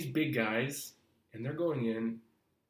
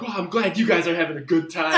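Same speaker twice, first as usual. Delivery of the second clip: loud, biting sarcasm; mad sarcastic retort; 5 semitones higher, 11 dB louder than usual. Retold or imitated. Angry actor to laughing casting room, loud sarcasm. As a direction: snap it back at the laughing room. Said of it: "And I'm mad".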